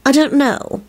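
put-on high, uncertain girlish character reply; nervous. A narrator's reading. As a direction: answer timidly, pitch up. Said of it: "she replied nervously".

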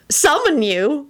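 high, amused question; giggly. A narrator's reading. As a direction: ask it tickled by the word. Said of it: "Elizabeth giggled".

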